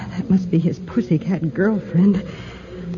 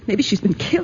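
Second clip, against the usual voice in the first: fearful cry. Acted. Fearing the wife is dead.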